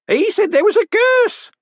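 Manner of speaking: enjoy saying it; old woman delighting in a spooky tale; relishing old woman. Acted. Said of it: "as if the idea was rather pleasing".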